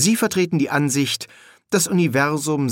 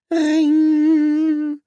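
long, high sung-out ring; phone ring; 5 dB louder than usual. A narrator's reading. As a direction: imitate a shrill phone bell. Telephone rings in story.

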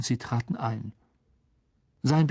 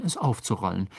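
ordinary delivery

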